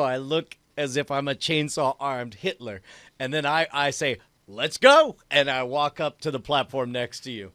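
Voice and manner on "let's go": loud, higher call to move; rallying cry. Acted. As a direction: call it out to the group. Player voices his character's rallying cry.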